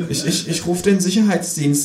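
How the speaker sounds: high-pitched, stuttering threat; high, hesitant boy voice; stammering boy. A narrator's reading. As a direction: stumble into it as a timid teen cashier. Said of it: "sagt der Junge".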